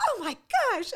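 high, loud mock gasp of shock; high loud mock dismay. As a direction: throw up a shocked oh my gosh. His mock shocked reaction.

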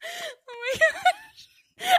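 very high, stretched-out squeal; helpless laughter. Real, not acted. Host cracking up over co-host's picture complaint, laughing squeal. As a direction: squeal it slowly through laughter.

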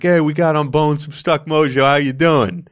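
put-on big New York-accented guest intro. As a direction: play up an old New York accent, loudly. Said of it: "This sounds very New York".

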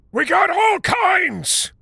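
put-on slow, high, loud old man's shout; old man echoing his friend with a shout. A narrator's reading.

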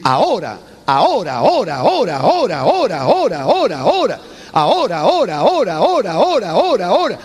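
each "ahora" punched with a falling accent; rhythmic emphatic chant of "ahora"; forceful repetition. Shown as a demonstration. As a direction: hammer out "ahora" over and over. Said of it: "estoy usando una fuerza de voz".

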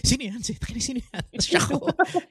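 high-pitched mock questioning, repeated; imitated wary caller. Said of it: "She's like".

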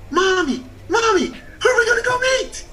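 very high, imitated child's excitement; excited little boy. Little son asks mommy who they'll meet, imitated excitedly. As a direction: squeal it like an eager small boy. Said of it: "Excited like little boys get".

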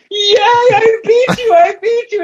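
very high, slow, squealing victory cry; a bartender crowing that he finally won; gleeful squeal. Acted. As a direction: squeal with childish triumph.

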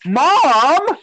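loud, high, drawn-out wail; sister's cry of Mom. Retold or imitated. Teen sister to her mother in church, loud outcry. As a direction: call out Mom, swooping up loud. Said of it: "looks at my mom and goes".

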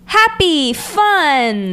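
high, loud cheerful holler; imitating a goofy anime yell; mock yelling. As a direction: draw each word out like a shouted greeting. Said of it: "he starts yelling".

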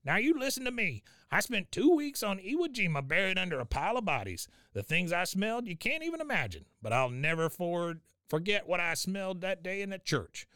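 high, in-your-face war-story rant; Cotton. Retold or imitated. Cotton grabs Hank by the collar, high intense rant. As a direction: lecture him up close, pitched well up. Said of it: "start kinda talking right in his face".

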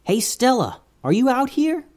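high, bright call into the yard. Acted. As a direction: call out to find her. Patrick calls for the mouse.

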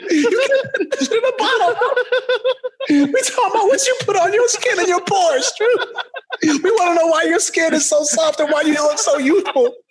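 high, wheezy laughing speech; crying with laughter. Real, not acted. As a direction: squeeze the words out through fits of laughter. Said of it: "I'm crying right now".

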